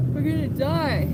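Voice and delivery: a frantic, high plea; high panicked cry. Real, not acted. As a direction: pitch it up in panic. Freezing, despairing on a bench.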